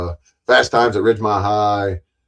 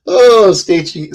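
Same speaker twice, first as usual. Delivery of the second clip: ordinary talk, nothing marked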